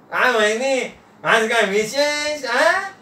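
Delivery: high-pitched guest, rising to a squeak; high, eager questioning voice; curious Nana. Acted. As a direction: inquire coyly, pitch climbing at the end.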